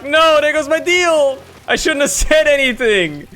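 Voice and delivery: high, loud, stretched-out outburst; real gamer rage, loud and high; frustrated yell. Real, not acted. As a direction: yell at the screen as the deal slips away. Losing a deal mid-fight.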